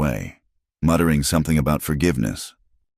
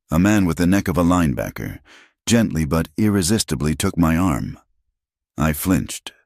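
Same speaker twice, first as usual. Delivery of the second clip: ordinary delivery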